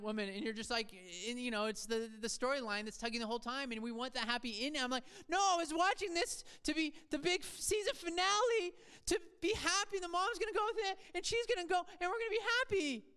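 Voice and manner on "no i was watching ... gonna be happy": high-pitched, loud exasperated rant; mock outrage. Let-down fan ranting at a show's season finale, mock outrage. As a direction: protest in a hammed-up high voice, tumbling faster at the end.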